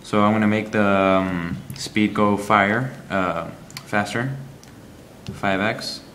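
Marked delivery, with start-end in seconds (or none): none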